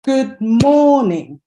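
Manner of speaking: light "Good", big long "mor", falling "ning"; stress on MOR. Shown as a demonstration. Teacher clapping where the stress is, slow "Good morning" stressed on MOR. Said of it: "clapping where I'm putting the stress".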